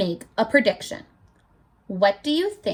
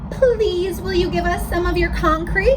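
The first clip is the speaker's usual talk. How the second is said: polite wolves; 6 semitones higher, 4 dB louder than usual. Acted. Wolves politely ask the beaver.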